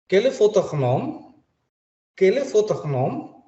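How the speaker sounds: "Quel est votre nom?" high, slow, the final E of "votre" not said; slow French model. Shown as a demonstration. Teacher says "Quel est votre nom?" slowly, high. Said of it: "kalau ada kata votre".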